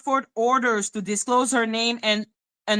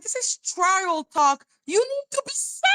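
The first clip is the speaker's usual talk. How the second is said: mock warning; 8 semitones higher than usual. Mocking the opponent's discovery talk in a high voice. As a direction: warn in a silly high voice. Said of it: "I'm like".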